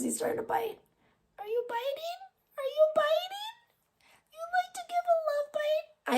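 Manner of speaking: high, soft cooing to the cat. Real, not acted. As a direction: tease the cat sweetly. Baby talk to biting cat.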